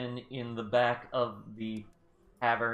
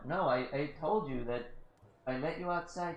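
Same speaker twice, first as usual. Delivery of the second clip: ordinary talk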